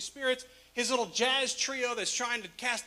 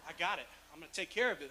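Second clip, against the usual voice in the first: cocky; 6 semitones lower, 11 dB quieter, faster than usual. Retold or imitated. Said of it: "with his chest like".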